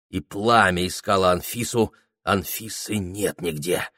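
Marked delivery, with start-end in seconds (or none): none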